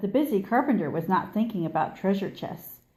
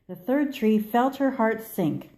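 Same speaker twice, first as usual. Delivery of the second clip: plain reading voice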